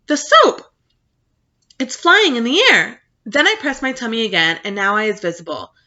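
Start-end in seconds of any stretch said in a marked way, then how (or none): 0.1-3.0 high, loud astonished cry about the soap; excited father yelling. Retold or imitated. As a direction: shout it out, pitched way up in amazement. Father pointing at floating soap.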